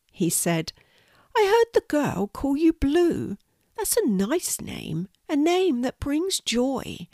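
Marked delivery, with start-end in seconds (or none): 1.4-7.1 high, sweet tiny spider; high spider, slowing wistfully at the end; little spider voice. Acted. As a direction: admire it in a small, high-pitched creature voice. Spider character's voice.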